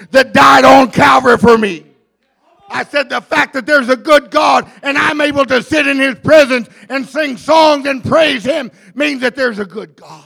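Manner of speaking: loud sermon crescendo; rising into a forceful preaching shout; full-force preaching. Real, not acted.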